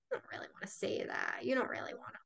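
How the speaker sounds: whispered ego doubt. Acted. The ego whispering you out of honesty. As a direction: hush it like a voice in your head.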